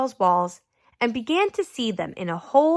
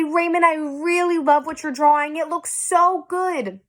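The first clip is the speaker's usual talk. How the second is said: higher, sugary sarcastic quote; mock nice brother; 6 semitones higher than usual. Teacher asking the class, mock sweet brother voice. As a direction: ham up a kindness he never showed. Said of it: "Did Leon say".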